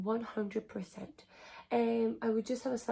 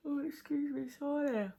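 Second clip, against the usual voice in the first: words pulled out by a yawn; 5 semitones higher than usual. Real, not acted. Mum filming her hospital bag list, mid yawn. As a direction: excuse me stretched through a real yawn. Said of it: "I don't know why I'm yawning so much".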